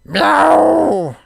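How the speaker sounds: high, loud cat meow; pirate meow. Pretend. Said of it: "Can you do that one?".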